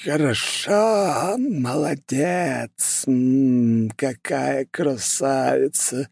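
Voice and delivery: put-on high, slow, creepy old sorcerer; eerie voice. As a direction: drag each word out like a ghoulish old man. Sinister old curse-maker voiced.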